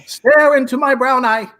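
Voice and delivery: high, loud villain command. Acted. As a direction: order them to stare, grandly.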